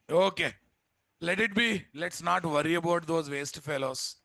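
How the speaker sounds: high, loud, fed up; exasperated. Real, not acted. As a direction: throw up your hands at them. Exasperated scolding of class.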